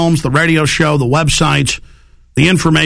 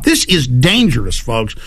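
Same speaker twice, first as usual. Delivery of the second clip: ordinary talk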